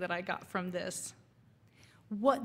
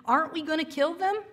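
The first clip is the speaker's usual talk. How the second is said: higher, louder and fast; urgent demand; 7 semitones higher, 7 dB louder, faster than usual. A narrator's reading. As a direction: blurt it out, itching to act. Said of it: "one youth demanded".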